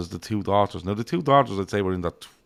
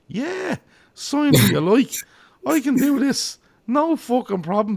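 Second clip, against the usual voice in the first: much higher, slow, eager mock voice of Brendan; mock Brendan Rodgers; 5 dB louder, slower than usual. Manager pitching himself to the Chelsea owner, mock eager voice. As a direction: ham up the eager manager promising the job. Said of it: "basically told Todd Bowley".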